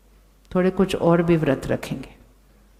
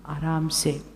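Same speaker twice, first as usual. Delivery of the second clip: soft and calm; 4 semitones lower, 7 dB quieter, slower than usual. Shown as a demonstration. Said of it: "हम कैसे बोलेंगे?".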